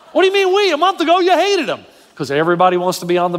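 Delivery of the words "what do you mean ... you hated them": high, scoffing comeback; incredulous retort. Retold or imitated. Friend mocking a bandwagon Dodger fan, high and scoffing. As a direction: call out the fair-weather fan.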